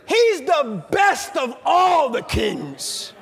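slow, drawn-out preacher's declaration; emphatic proclamation. Acted. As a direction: announce it like the best news. Proclaiming Josiah's greatness.

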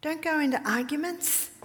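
usual speaking voice

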